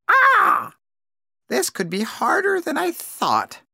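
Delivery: a worried bird thinking aloud; crow to herself. A narrator's reading. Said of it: "said Crow to herself".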